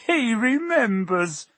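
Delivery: high, slow, thrilled; excited cry. Acted. Diggle thrilled Harry remembers him, excited cry. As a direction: cry it out with joy. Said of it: "cried Daedalus Diggle".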